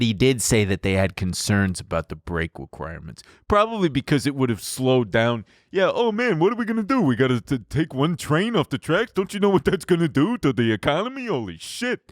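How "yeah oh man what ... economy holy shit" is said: mock panicked executive.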